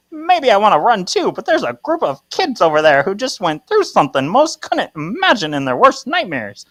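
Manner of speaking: high, loud, hammed-up old-timer; Ben's old-time voice. Ben answering Kira's breakup, in a high old-time character voice. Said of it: "how did he talk?".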